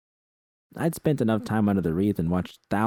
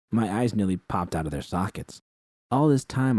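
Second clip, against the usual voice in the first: ordinary delivery, nothing marked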